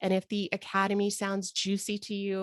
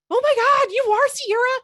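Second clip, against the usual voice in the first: high, loud delighted exclamation; excited surprise; 10 dB louder than usual. Real, not acted. Host recognizing Sierra on the call, thrilled. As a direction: squeal it out, thrilled.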